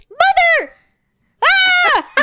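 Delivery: high shrieking mother then a yell; cut-off scream. Retold or imitated. Mother whose parking spot was stolen screaming in frustration. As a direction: scream it but stop before the curse. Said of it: "I was just like".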